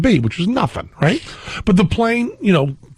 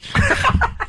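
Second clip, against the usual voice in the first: laugh. Real, not acted. Laughs at own joke.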